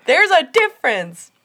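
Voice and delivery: loud, high, pleading yell; re-enacted exasperated shouting. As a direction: holler advice at the television. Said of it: "I was yelling at the television".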